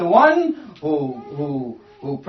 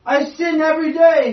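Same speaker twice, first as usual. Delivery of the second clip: higher, louder confession; crying out for mercy; 7 semitones higher, 6 dB louder than usual. Retold or imitated. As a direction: beg it out loud, stressing day. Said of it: "And he's crying out for mercy".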